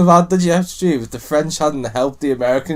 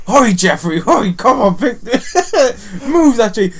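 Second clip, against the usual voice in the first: high, loud, laughing orders; posh boss shouting at his man; mock shouting boss; 10 semitones higher, 8 dB louder than usual. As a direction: hurry the servant along, cracking up. Acted boss shouting at Jeffrey.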